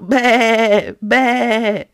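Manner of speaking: loud, drawn-out mock bleating; playful farm-animal baa; goat bleat. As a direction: imitate a goat in the background. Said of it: "What kind of sound does the goat make?".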